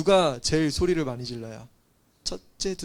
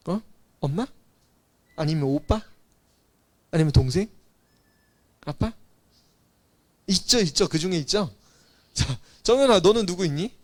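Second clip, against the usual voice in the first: ordinary delivery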